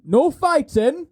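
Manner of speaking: high, drawn-out mock warning; mock-stern command. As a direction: lay down the rule in an exaggerated high voice. Mock-stern rule for the players.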